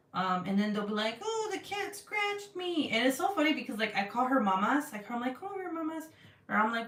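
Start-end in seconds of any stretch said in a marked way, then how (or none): 1.2-2.9 high, drawn-out mock kid complaint; put-on little-kid voice, milking the complaint; imitating complaining kids. Imitating her boys complaining about the cat. 5.4-6.0 high, soft coo to a kitten; softly imitated baby talk for a pet. Calling her kitten by pet name.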